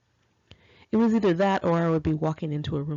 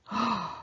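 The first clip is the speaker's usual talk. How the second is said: gasp; 4 semitones higher, 4 dB quieter than usual. Real, not acted. Hears favorite band playing.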